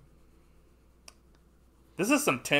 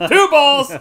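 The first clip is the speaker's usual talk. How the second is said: laughing yell; 19 dB louder than usual. Real, not acted. Laughing at the rocket joke.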